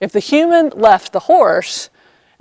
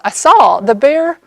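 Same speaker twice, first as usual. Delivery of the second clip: ordinary talk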